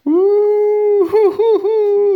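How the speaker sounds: very high, loud hooting sobs; mock crying wail. Grandma walking off crying along the road, acted wailing. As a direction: howl "uh-uh" in exaggerated crying. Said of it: "iba llorando, llorando".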